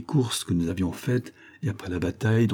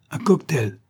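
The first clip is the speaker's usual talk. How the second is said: higher, excited cry; eager exclamation; 7 semitones higher than usual. A narrator's reading. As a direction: blurt it out with enthusiasm. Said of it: "s'écria Harry". (s'écrie avec entrain)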